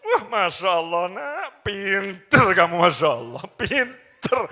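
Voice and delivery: slow, drawn-out admiring coo; doting praise. Acted. Mother praising her child as clever, doting praise. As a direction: praise the child slowly and lavishly. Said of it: "ngomong yang bagus".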